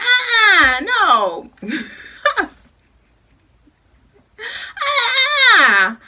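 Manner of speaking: high, loud laughing uh-uh; laughing refusal. Retold or imitated. Refusing the friend turning the radio up, laughing. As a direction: refuse while cracking up.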